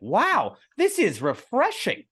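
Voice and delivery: high, slow, amazed squeal; wowed drinker. Retold or imitated. Pool party guest tasting the beer, high amazed gush. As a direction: gush it like a stunned first sip. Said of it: "And they're like".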